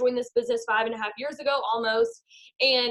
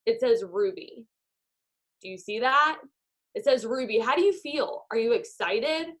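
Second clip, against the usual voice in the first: walk them through it like a meditation; guided visualization. Acted. Guided visualization over Zoom.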